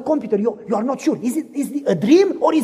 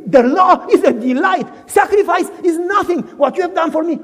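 high, loud jubilant shout with a laugh; exultant declaration; joyful praise; 6 semitones higher, 5 dB louder than usual. Acted. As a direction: rejoice out loud. Saved believer's joyful praise.

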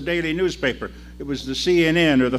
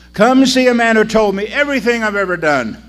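louder, higher call across the street; shouting; 9 semitones higher, 9 dB louder than usual. Acted. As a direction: call it again, louder and more urgent. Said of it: "she shouted out".

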